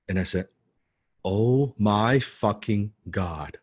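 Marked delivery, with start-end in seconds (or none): 1.2-3.6 drawn-out, slow retold awe; starstruck excitement. Fan realizing he has met his idol, slow amazed excitement. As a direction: stretch each word like an amazed fan. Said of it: "I was jumping up and down".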